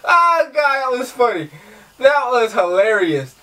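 high, slowing laugh-talk. Real, not acted. Recovering after laughing, still giggling.

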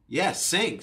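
usual speaking voice

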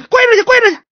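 very high, loud dismissal; exasperated order. Acted. Magistrate sending the stutterer back to kneel, exasperated. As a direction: cut him off and banish him.

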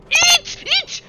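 crie fort et aigu à chaque saut; cris aigus. Real, not acted. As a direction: hurle dans le micro sans retenue. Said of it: "Pardon, excusez-moi pour vos oreilles". (cris perçants en pleine action)

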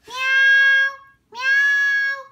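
high, loud drawn-out meow; loud high cat meow. A narrator's reading. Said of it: "maulló el gato".

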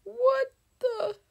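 high, loud put-on wail; mock crying. Streamer to viewers as the game changes to dark mode, mock crying. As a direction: wail it like a hammed-up sob. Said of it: "I'm just gonna start crying".

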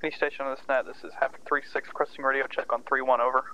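radio voice. Real, not acted. Radio check over comms.

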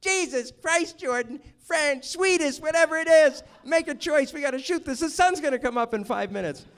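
high, loud exasperated yell; impatient cameraman shouting to hurry. Retold or imitated. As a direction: blow up at the fussy director, hammed up. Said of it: "it's like".

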